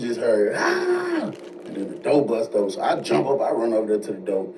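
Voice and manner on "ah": sudden high wail, overwhelmed with emotion; a high, raw scream of a man breaking down; retold anguished scream. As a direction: let out a piercing cry of grief. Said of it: "screaming stuff".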